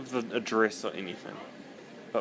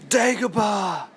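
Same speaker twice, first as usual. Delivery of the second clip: loud high shouted impression; Star Wars shout; 10 semitones higher, 6 dB louder, slower than usual. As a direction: call it out like a movie character.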